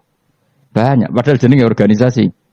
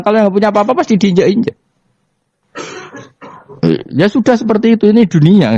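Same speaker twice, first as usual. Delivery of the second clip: much higher and fast, pressing the point; fast emphatic; 12 semitones higher, faster than usual. Real, not acted. Preacher warning the congregation about the world, fast and high. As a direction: rush it out, fired up and insistent.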